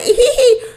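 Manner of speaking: a short high burst of laughter; real amused laugh. Real, not acted. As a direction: laugh out at the threat. Laughing at Morgan's threat.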